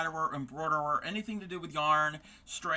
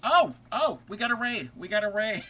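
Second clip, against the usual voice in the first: sudden high, loud burst of surprise; excited surprise; 5 semitones higher, 5 dB louder than usual. Real, not acted. Streamer spotting a surprise raid, excited and loud. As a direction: caught off guard by a raid, thrilled.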